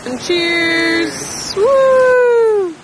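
high, loud cheering toast; happy toast at dinner, cheering loud; excited cheer. Real, not acted. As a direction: raise a glass and whoop.

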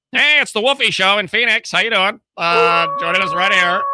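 high, loud hammed-up bro host; radio host voice. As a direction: pump it up like a morning show host. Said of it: "it's gonna be like".

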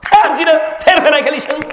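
acted outburst, high and sharp; exasperated son. Son to forgetful father on third asking, exasperated shout. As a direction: blow up at being asked a third time. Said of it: "তৃতীয়বার জিজ্ঞেস".

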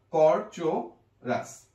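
কর চো রাস said slowly with clear কর; slow careful demo. Shown as a demonstration. Teacher correcting a common mistake, slowly. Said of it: "অনেকে কো দি-, দেয়".

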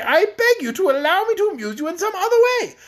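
high-pitched, desperate begging; pleading. A narrator's reading. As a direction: bargain desperately to escape tickling. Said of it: "pleaded poor Rinkitink".

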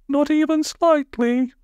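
very high, slow timid question; squeaky, hammed-up worry; anxious. A narrator's reading. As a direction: ask it nervously in a tiny high voice. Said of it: "asked one of the menfolk anxiously".

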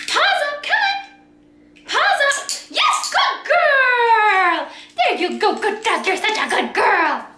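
high sing-song praise; talking to a dog. Real, not acted. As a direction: cheer the dog on, bright and high.

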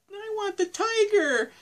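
put-on very high, squeaky child; imitating a child in falsetto; little kid voice. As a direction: plead like a small kid. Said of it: "to when he's a kid".

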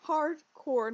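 "Hardcore" said loud with a hard "core"; clearly spelled out correction. Shown as a demonstration. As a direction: stress "Hardcore", both syllables crisp and loud. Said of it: "What's he cord?".

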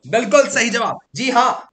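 high, loud cheer; excited praise. Real, not acted. Teacher cheering students' right answer, high and loud. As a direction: burst out like the answer made your day.

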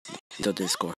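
ordinary talk

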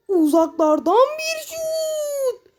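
slow, high drawn-out commentator cry; mock commentator. Gamer narrating his own long shot, drawn-out commentator call. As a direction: stretch the call like a TV announcer.

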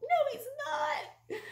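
very high, drawn-out protest; exasperated whine, hammed up; annoyed denial. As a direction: stretch out a high-pitched objection. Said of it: "I was just so annoyed".

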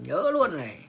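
ordinary talk

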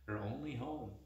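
usual speaking voice

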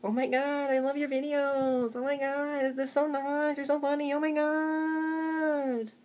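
high, slow, gushing fangirl; gushing fan. Retold or imitated. Fan commenting on his videos, high gushing squeal. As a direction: squeal with adoration. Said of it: "people comment or tweet you".

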